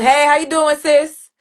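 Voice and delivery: loud, high friendly street greeting; hearty neighborhood hello; neighbor calling out. Retold or imitated. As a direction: call out hey sis across the street. Neighbor greeting her loudly on street.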